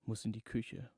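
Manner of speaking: plain reading voice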